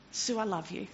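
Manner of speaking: soft, gentle reassurance; still small voice. Acted. Preacher voicing God's gentle comfort to Sue. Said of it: "that still small voice that says".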